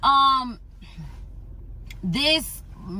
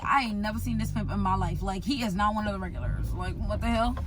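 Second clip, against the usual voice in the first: muttered thought; 5 semitones lower, 5 dB quieter than usual. Retold or imitated. Inner thought, lowered voice.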